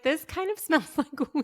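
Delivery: giggling her line up high, playfully; mock high-pitched reality star. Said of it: "that was so funny when she's like".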